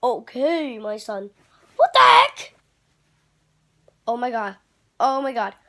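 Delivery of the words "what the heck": sudden loud high "what the heck"; loud startled yelp; startled outburst. Acted. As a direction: jolt awake in shock. Puppet wakes in heaven.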